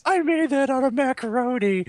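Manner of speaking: high, loud indignant wail; mock outrage. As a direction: protest in a loud, high comic voice. Acted outburst in the dad bit.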